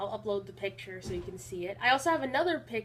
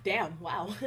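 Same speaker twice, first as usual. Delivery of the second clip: normal talking voice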